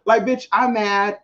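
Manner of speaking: usual speaking voice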